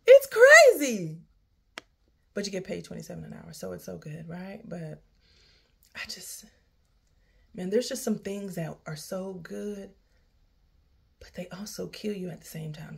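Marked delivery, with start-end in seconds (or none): 0.1-1.2 high, loud, slow exasperated cry; exasperated outburst. Real, not acted. Venting about the job, exasperated cry. 6.0-13.0 weary near-whisper. Real, not acted. Defeated venting about the job.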